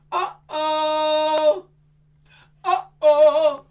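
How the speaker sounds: high loud drawn uh-oh. Shown as a demonstration. Magician leading kids, loud high uh-oh. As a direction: call it out for them to copy.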